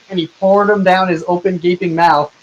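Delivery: ordinary talk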